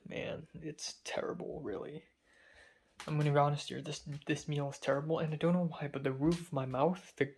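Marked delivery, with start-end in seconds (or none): 0.1-2.0 breathy, unvoiced whisper of disgust; whispered complaint. Real, not acted. Grumbling to the camera about a terrible meal, whispered. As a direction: whisper it like a private gripe.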